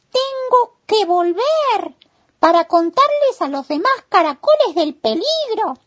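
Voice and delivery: vocecita de caracol muy aguda y fuerte; proclámalo con urgencia, en voz agudísima de caracolito; caracol alarmado. Acted. El caracol decide alertar a otros. (caracol alarmado, agudo y fuerte)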